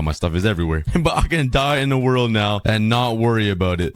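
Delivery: ordinary talk, nothing marked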